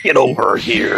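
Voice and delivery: Mortal Kombat fighter voice. Pretend. Mortal Kombat fatality joke.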